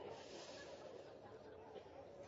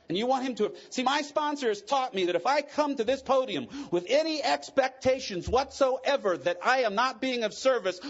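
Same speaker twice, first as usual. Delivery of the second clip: ordinary talk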